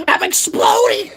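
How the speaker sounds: high slow mock shriek; hammed-up exasperated squeal; screeching in mock frustration; mock shout. Mock woman ranting.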